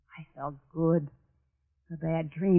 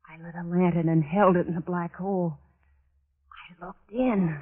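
ordinary talk, nothing marked

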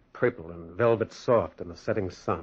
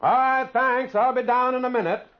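high, carrying call; shouted reply; 13 semitones higher, 4 dB louder than usual. Acted. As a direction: holler thanks down the slope. Shouting back down before descending.

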